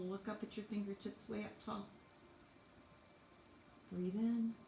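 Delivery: ordinary delivery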